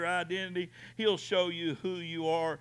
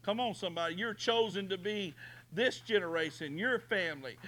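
ordinary delivery

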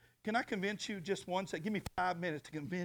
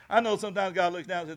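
usual speaking voice

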